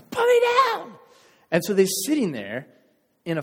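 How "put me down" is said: very high, loud panicked shriek; freaking out. Acted. Manager stuck halfway on the line, high panicked scream. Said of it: "the other guy freaks out".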